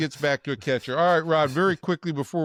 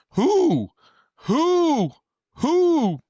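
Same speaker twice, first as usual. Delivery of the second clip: very high, louder hooting who; owl hoot; 6 dB louder, much slower than usual. Retold or imitated. Host retelling manager turning owl, loud high hoots. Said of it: "Skip Schumacher turns into an owl".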